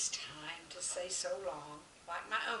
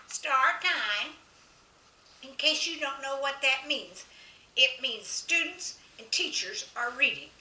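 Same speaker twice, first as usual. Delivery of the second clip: raised, booming teacher voice; loud croaky announcement; 6 semitones higher, 9 dB louder than usual. Acted. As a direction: boom it out like the frog teacher. Frog substitute's board words voiced.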